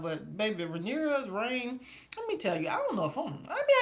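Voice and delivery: ordinary talk, nothing marked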